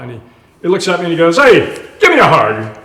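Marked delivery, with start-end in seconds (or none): 1.4-2.8 loud, hearty imitation of an Australian doctor. Australian doctor greeting a nervous new patient, loud imitation. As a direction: boom it out like a brash Aussie. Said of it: "in walks this kind of ruddy-faced Australian fella".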